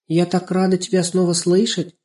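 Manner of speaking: put-on high, gently happy girl's voice; glad little girl. A narrator's reading. Girl reunited with her little friend, glad. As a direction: greet a missed friend gladly.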